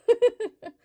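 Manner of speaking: high, loud burst of giggles. Real, not acted. Crocheter admitting a needless run upstairs, high giggle. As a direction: laugh at your own silliness.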